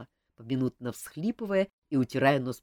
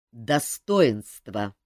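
slow, louder single hard word; harsh; 5 semitones lower, 7 dB louder, much slower than usual. Acted. King rebuking the favourite, harsh and slow. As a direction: lay down the word like a verdict. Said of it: "жёстко произнёс".

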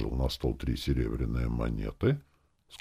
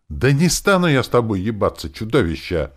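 loud, high outraged refusal; exclaiming; 7 semitones higher, 7 dB louder than usual. A narrator's reading. Woman rejecting the client's crude offer, exclaiming. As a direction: burst out in protest, falling at the end. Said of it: "воскликнула Лика".

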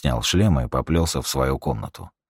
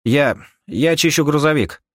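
raised radio reply; 11 semitones higher, 5 dB louder than usual. Acted. Rika answers over radio.

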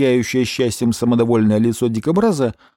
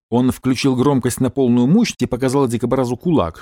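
ordinary delivery

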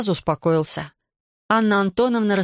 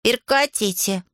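slow, higher call, no real volume; mild shout; 4 semitones higher, slower than usual. A narrator's reading. Classmate stops a brewing fight, mildly shouting. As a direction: call a halt with understated force. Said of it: "крикнул Алик".

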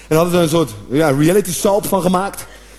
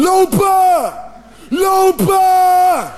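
shouting at the TV; 11 semitones higher, much slower than usual. Retold or imitated. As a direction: yell at the players to run. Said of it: "lekker te schreeuwen naar een televisie". (schreeuwen naar de televisie lopen)

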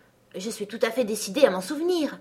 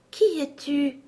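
fawn voice; 6 semitones higher, slower than usual. Acted. Fawn speaks in character voice.

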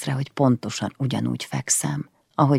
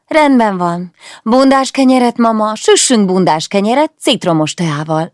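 eager request; 8 semitones higher, 9 dB louder than usual. Acted. Daughter cheerfully requests breakfast.

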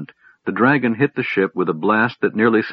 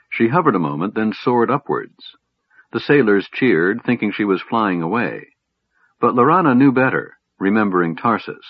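ordinary delivery, nothing marked

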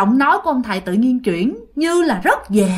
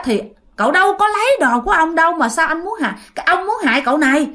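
higher-pitched retold objection; agitated imitated questioning; protesting; 6 semitones higher, faster than usual. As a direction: plead the accused's case, imitating. Priest argues with the spirit.

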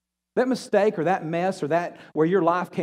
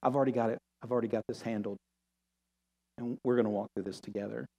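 soft, low, hushed comfort; quiet promise that it's handled; tender reassurance; 5 semitones lower, 4 dB quieter than usual. Acted. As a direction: speak gently to a hurting child. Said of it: "And God's saying".

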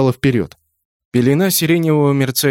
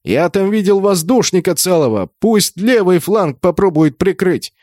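higher, urgent battle report; raised, insistent commander's call; calling out orders; 6 semitones higher than usual. A narrator's reading. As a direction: call instructions to a comrade.

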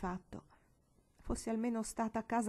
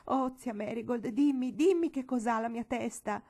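pleading; 4 semitones higher, 5 dB louder than usual. Acted. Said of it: "supplicò".

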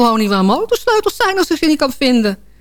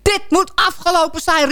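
exploding husband; 7 semitones higher than usual. Retold or imitated. Husband rages about the gadget to his wife, exploding. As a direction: imitate a husband blowing up. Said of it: "hij ontplofte werkelijk".

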